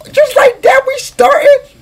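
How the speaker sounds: high, loud burst of amazement; gasp, then blurt it out way up high; excited disbelief. Real, not acted. Reacting in amazement to game start.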